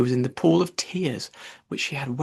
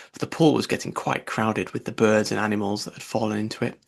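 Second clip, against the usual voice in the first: usual speaking voice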